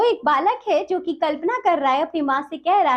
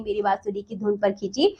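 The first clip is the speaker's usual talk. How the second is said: usual speaking voice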